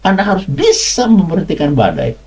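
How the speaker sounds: preacher rising high to insist; emphatic high exclamation. Acted. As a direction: proclaim it like a revelation. Preacher insists on God's power.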